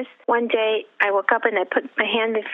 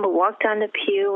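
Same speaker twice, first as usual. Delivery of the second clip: ordinary talk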